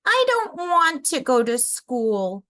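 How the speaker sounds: put-on high, pleading child voice; a child close to tears; crying child. A narrator's reading.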